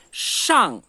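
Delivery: Shang com A bem aberto, descendente; open shang. Shown as a demonstration. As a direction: abra bem a boca no Shang. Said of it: "abrir a boca pra ele sair". (shang oral, boca aberta)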